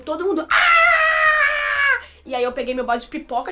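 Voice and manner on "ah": very high, loud, sustained shriek; crowd scream, imitated. Everyone answering the popcorn offer, imitated crowd scream. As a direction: scream like a whole crowd of fans.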